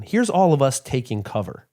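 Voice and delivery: ordinary delivery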